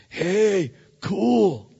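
bright wondering exclamation; excited. Retold or imitated. Pastor recalling the boat ride, excited. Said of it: "And I'm excited".

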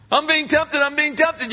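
whiny complaint. Retold or imitated. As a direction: play the pitiful tempted believer. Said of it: "You ever notice that?".

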